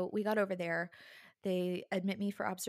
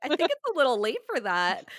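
higher, louder deadpan comeback; dry retort; 6 semitones higher, 10 dB louder than usual. Retold or imitated. New mother answers the epidural doctor, dry and amused. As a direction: tease him with a dry, raised voice. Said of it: "I'm like".

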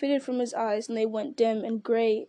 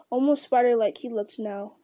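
ordinary delivery, nothing marked

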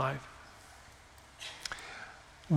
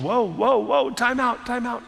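higher, urgent mock alarm, calling a halt; alarmed time out; 6 semitones higher than usual. Preacher voicing a soul calling time out, higher and urgent. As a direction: throw on the brakes, like a startled coach. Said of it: "if his soul was going".

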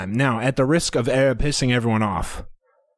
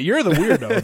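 laughing; 8 semitones higher than usual. Real, not acted. Laughing teasing.

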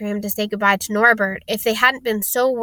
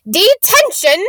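high, loud outraged punishment; indignant high-pitched bark; shouted; 10 semitones higher, 7 dB louder, slower than usual. A narrator's reading. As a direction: shout it as a stern professor. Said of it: "she shouted".

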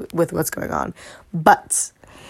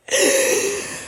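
long high sigh, emotion spilling over; heavy sigh; 4 dB quieter than usual. Real, not acted. Vlogger sighing over a baking show finale, wistful sigh. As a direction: let out a big wistful sigh.

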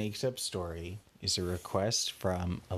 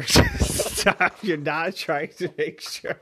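laughing; 9 semitones higher, 7 dB louder, slower than usual. Real, not acted. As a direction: burst of high laughter. Cracks up at the child.

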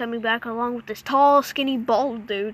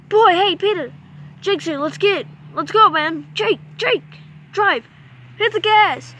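urgent calling out; 6 semitones higher, 3 dB louder than usual. Pretend. Escaping, calling to friend and driver.

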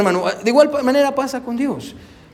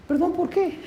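much higher and softer, playfully puzzled; wife's teasing reply; 9 semitones higher, 8 dB quieter than usual. Retold or imitated. Pastor voicing his wife asking what for, high and soft. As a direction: play his wife pretending not to know. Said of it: "¿Qué me dice mi hermana, mi esposa?".

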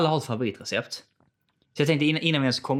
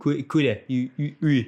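exaggerated Skåne dialect demo; broad Skåne accent; 4 semitones higher, 3 dB louder, slower than usual. Shown as a demonstration. Friend shows how Skåne words sound, broad Skåne accent. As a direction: mimic thick Skåne vowels, raised pitch. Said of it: "eller så har du jätteskånsk".

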